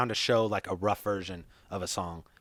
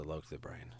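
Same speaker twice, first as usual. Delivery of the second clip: breathy whispered song title; 7 semitones lower, 14 dB quieter than usual. Shown as a demonstration. Recalling the song he rapped, in a whisper. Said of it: "I knew all the words to".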